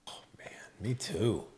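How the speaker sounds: hushed, breathy-free whisper of agreement; conspiratorial whisper to the host; playful whisper. Pretend. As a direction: whisper it like a shared secret. Co-host reacting to steak craving.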